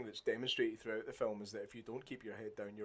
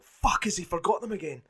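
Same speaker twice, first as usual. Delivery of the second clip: panicked viewer; 8 semitones higher, 7 dB louder than usual. Retold or imitated. Viewer panicking over lost papers.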